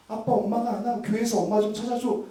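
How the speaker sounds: a small son begging dad to find mom; child's plea. Retold or imitated.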